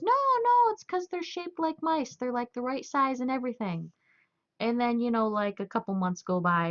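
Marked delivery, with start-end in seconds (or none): 0.0-3.9 high, insistent mock father-in-law; imitating father-in-law. Father-in-law insisting the cats like the mouse shape, high and stubborn. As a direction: raise the pitch and insist stubbornly. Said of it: "And he goes".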